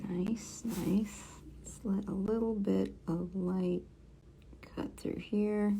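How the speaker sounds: breathy murmur to herself while concentrating; soft whispered self-talk. Real, not acted. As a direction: whisper under your breath as you work. Quiet focus while drawing.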